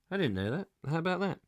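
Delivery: usual speaking voice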